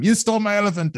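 high-pitched mock scream at modest volume; retold movie scream. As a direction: imitate a screaming action hero without really yelling. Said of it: "he screams the entire time".

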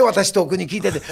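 usual speaking voice

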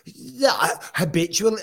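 incredulous echo. Real, not acted. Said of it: "[name]'s out of his mind".

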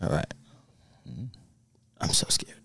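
nervous hushed whisper. Real, not acted. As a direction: whisper it so nobody on the line hears. Whispering before calling grandma.

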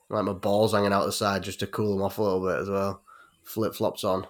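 ordinary talk, nothing marked

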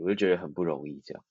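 ordinary delivery

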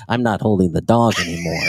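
low mock-sullen refusal; imitated fed-up passenger. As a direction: pitch it down, refusing like him. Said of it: "He's sitting there going".

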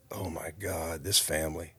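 very soft, scandalized murmur; hushed aside. Retold or imitated. Front-desk ladies eyeing father and son, hushed aside. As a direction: say it under your breath as an onlooker. Onlooking ladies' disapproving thought.

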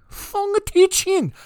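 high, drawn-out put-on Vietnamese accent; mock Vietnamese accent. Shown as a demonstration. Host insisting the name needs the accent, hammed-up Vietnamese accent. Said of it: "I've gotta do the accent".

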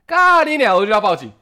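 very high, loud swear; loud enraged profanity; furious curse. Real, not acted. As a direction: burst out in anger. Angry outburst.

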